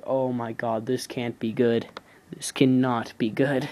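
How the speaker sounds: retold quiet dread; dreading whisper-quiet. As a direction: hush it like trouble is coming. Retelling dread as cops arrive.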